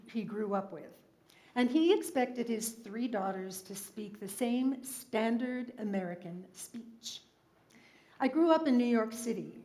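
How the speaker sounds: normal talking voice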